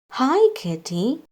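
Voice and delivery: ordinary delivery, nothing marked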